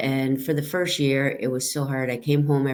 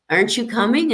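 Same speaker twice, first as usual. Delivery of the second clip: higher, louder retold call; a higher, louder mock call of aren't you coming; retold colleague calling; 8 semitones higher, 5 dB louder, faster than usual. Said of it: "and they'd be like".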